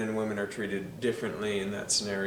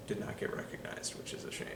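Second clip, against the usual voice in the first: near-whispered lament, much softer; hushed aside; 12 dB quieter, faster than usual. Real, not acted. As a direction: drop to a voiceless murmur of regret. Aside lamenting the actress's snub.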